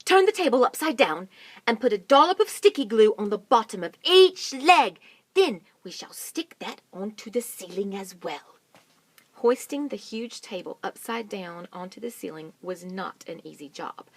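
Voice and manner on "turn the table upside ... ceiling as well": loud urgent directions; loud instructions. Acted. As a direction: direct the crew. Mugglewump instructing.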